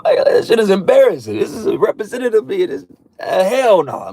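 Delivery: high, put-on voice with a crying edge; mock tearful divine complaint; the Lord, fed up. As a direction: whine it like an embarrassed deity. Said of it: "how I hear it in the spirit".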